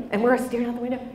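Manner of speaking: ordinary talk, nothing marked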